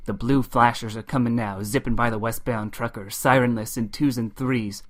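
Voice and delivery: ordinary delivery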